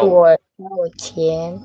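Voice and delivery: "Tuo shao jian" said slowly with a clear E in jian; teacher's slow demo of "Tuo shao jian"; model pronunciation. Shown as a demonstration. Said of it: "agak E dikit".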